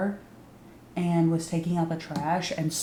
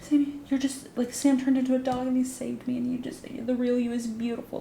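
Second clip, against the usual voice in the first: higher, dreamy mock drugged voice; drugged-up gushing; 7 semitones higher than usual. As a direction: imitate someone sweetly high on drugs. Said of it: "She's high on drugs".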